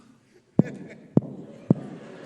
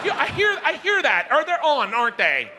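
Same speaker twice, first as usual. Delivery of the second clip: much higher, chirpy mock voice; mock housewife; 9 semitones higher than usual. Comedian mocking a wife mid-act, high mock voice. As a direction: ask it like a distracted suburban wife.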